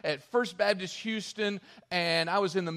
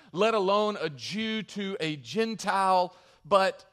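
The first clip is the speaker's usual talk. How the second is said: usual speaking voice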